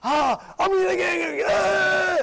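high, loud mock tantrum; hammed-up cry of rising rage; getting angry. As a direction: build to a high yell. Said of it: "getting angry is a desire".

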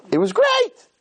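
mock girlish delight, way up high; gushing girl. Girl gushing to her father.

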